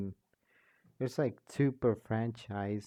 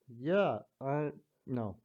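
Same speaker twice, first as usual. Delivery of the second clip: plain reading voice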